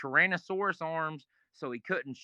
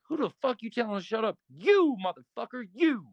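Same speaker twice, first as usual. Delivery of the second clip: higher, louder confrontational snap; angry challenge; 6 semitones higher, 4 dB louder than usual. Retold or imitated. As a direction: call him out in front of everyone. Bull confronting the speaker.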